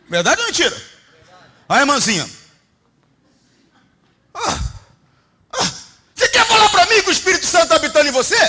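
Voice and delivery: loud, high-pitched preaching at full force; fiery preaching. Acted. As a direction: preach it hard to the congregation. Preacher rebuking congregation.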